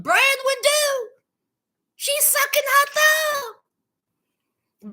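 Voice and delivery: high, slow disbelief, dragging the words; incredulous. Real, not acted. Vlogger in disbelief at Branwen sucking her thumb, high and drawn out. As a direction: stretch it out in shocked disbelief.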